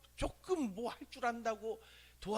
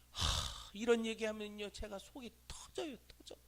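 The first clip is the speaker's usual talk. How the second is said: ordinary talk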